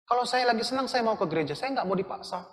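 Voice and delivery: high, whiny mock imitation of an excuse; mocking the churchgoer. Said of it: "gitu loh ngomongnya".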